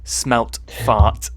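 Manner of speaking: "smelt fart" with each word set apart; deliberate slip. Shown as a demonstration. Showing what the twister is after, slow and deliberate. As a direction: land "smelt fart" slowly. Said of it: "That's what it's trying to do".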